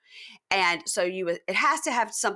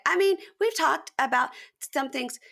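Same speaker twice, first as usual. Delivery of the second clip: higher-pitched imitation of a cagey reply; mock hedging reply; 7 semitones higher than usual. Retold reply in a recap.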